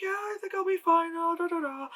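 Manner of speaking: mock a breezy, carefree girl brushing it off; high-pitched mock valley girl, waving it away; airy girl voice. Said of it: "And then she's just like".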